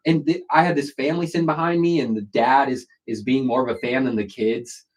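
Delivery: usual speaking voice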